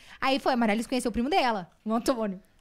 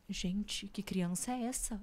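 disdainful aside; 9 dB quieter, much slower than usual. Retold or imitated. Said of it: "Ela com uma cara".

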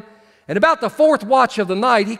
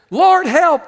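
cry for help; 7 semitones higher, 4 dB louder than usual. Retold or imitated. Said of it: "This is what they said".

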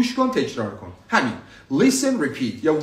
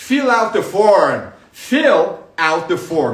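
louder, slow fill out the form; emphatic correct form; 7 dB louder, much slower than usual. Shown as a demonstration. Teacher loudly drills the fuller form for students. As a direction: punch fill out the form out loud. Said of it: "حالا out نه".

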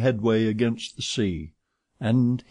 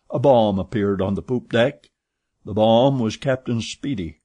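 ordinary delivery

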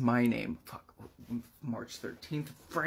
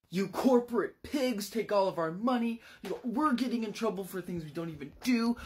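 angry rant; 6 semitones higher than usual. Retold or imitated. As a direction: rant at the boss, building fury. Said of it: "he begins rambling about".

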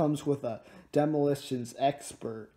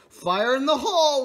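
"fire in the hole" called out higher; mock warning call; 10 semitones higher, 6 dB louder than usual. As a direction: call a playful blasting warning. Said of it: "you just go".

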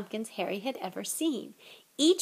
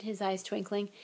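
ordinary delivery

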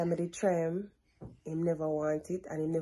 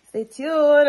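bright, loud stay tuned; cheery vlogger send-off; upbeat sign-off; 8 semitones higher, 11 dB louder than usual. Real, not acted. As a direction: hype the viewers up to keep watching. Vlog sign-off call to viewers.

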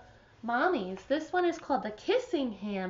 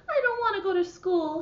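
higher, fast pleading little raccoon; whiny child; 6 semitones higher, 3 dB louder than usual. Acted. Chester tells his mother he won't go to school, higher fast whine. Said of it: "stood at the edge of the forest and cried".

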